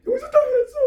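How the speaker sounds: very high squealed boast; triumphant child. Acted. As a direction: crow it in a child's voice. Child triumphantly defies the maid. (높은 아이 목소리로 의기양양하게)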